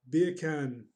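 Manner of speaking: attempted Jamaican accent, punched out. Shown as a demonstration. Streamer trying out chat's joke, Jamaican accent. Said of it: "in a Jamaican accent".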